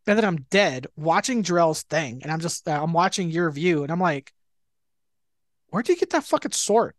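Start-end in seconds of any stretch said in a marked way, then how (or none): 5.7-6.9 mock incredulous, higher-pitched demand; retold amazement. Dead player watching his friend's view, retold amazement. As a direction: blurt it like a baffled, envious teammate. Retelling amazed reaction to sword.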